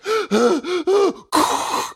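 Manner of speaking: high dramatic mock gasp. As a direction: gasp as if short of air. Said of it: "if someone is like".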